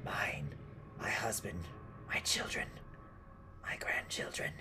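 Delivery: halting list fading into whisper; raspy old woman. Acted. Old woman owning up to the teeth, in a raspy whisper. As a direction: rasp each one out, with pauses.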